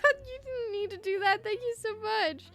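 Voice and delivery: high, giggly flustered thanks; flustered, embarrassed. Real, not acted. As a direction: squirm with delighted embarrassment. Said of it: "I'm getting embarrassed now".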